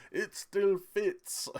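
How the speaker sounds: slow, high-pitched, badly faked Russian accent; terrible Russian accent. Streamer imitating an actor from the trailer, terrible Russian accent. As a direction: stretch it out in a hammy mock-Russian accent. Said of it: "that's my terrible Russian accent".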